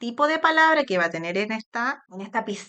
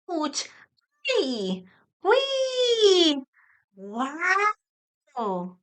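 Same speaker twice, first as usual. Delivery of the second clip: put-on child-like exclamations, one high squeal; playful interjections; 7 semitones higher, much slower than usual. Shown as a demonstration. Therapist modelling interjections for a child, playful. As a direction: act out little exclamations for a child. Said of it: "las interjecciones como".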